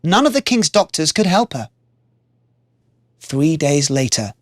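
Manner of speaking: usual speaking voice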